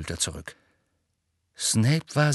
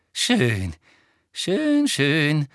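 gloating, lilting repeats; triumphant; 4 semitones higher, 3 dB louder, much slower than usual. A narrator's reading. Umbridge triumphant over Hermine, gloating. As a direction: savour the victory. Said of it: "mit triumphierender Miene".

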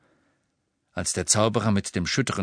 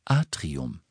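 lower, cool lift announcement; cool witch voice; 5 semitones lower than usual. A narrator's reading. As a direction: call the floor coolly. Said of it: "sagte die kühle Stimme der Hexe".

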